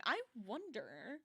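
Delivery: usual speaking voice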